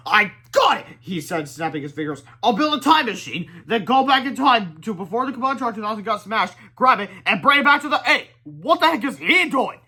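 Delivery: high, loud eager plan; high excited rush; excited brainstorm. Acted. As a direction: burst out with the idea. Inventor gets idea excitedly.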